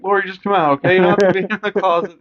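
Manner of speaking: high, coaxing sing-song voice; mock soothing killer. Joking as Michael, coaxing Lori out of the closet. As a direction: coax her out, fake gentle.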